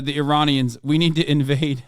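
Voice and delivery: ordinary talk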